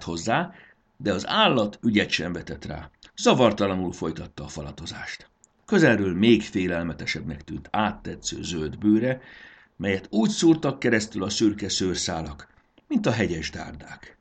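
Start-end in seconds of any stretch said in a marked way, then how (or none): none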